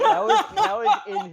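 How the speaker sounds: laughing. Real, not acted. Player laughs while explaining.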